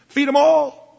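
emphatic call. Acted. Preacher's emphatic command.

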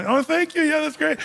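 a hint of a dazzled admirer; oblivious guest. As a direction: chirp back without hearing a word. Oblivious guest answering the president.

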